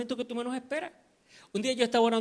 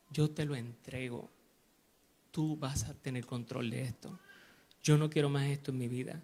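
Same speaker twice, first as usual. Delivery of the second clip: low, softened prayer of surrender; quiet, subdued retold prayer; 5 semitones lower, 6 dB quieter than usual. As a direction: hand it over to God quietly, voice lowered. Retells his surrendering prayer to God.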